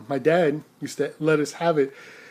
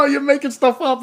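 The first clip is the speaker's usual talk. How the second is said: teasing friends; 11 semitones higher, 5 dB louder than usual. Retold or imitated. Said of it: "They go".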